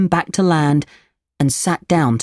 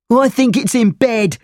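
put-on higher, taunting boy voice; schoolboy, mocking; 7 semitones higher than usual. A narrator's reading. Boy mocks the tricked Pinocchio, high taunting voice. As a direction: tease the victim, pitched up. Laughing boys mock Pinocchio.

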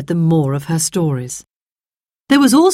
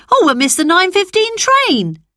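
urging call; 13 semitones higher than usual. Acted. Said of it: "said Peter".

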